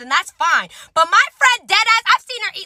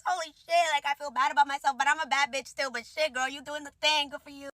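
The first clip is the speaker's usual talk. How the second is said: hushed aside; 11 dB quieter than usual. Retold or imitated. Muttered aside about waiter.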